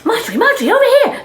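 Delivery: high, loud call out; photographer calling. Acted. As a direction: shout to get her attention.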